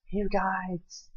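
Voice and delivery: high-pitched mock "you guys". Rejecting "you guys" in favor of y'all, high mocking voice. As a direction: squeak it mockingly, far above your own voice.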